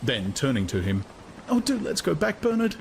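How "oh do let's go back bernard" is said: high pleading woman's voice; a woman begs her date to leave; beseeching. A narrator's reading. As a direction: beg him to turn back. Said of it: "she besought".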